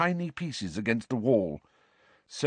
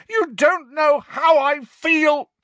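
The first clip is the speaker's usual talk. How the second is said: high, loud, slow roar; furious boy roaring at Dumbledore; 9 dB louder, slower than usual. A narrator's reading. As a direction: roar the accusation. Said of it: "Harry roared".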